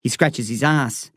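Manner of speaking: ordinary delivery, nothing marked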